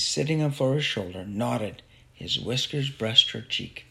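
ordinary delivery

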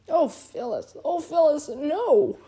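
high, despairing plea; squeaky, despairing voice. A narrator's reading. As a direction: wail it at a wife in dismay. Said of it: "in a squeaky, despairing voice".

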